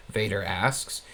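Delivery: ordinary delivery, nothing marked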